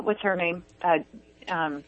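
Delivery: ordinary talk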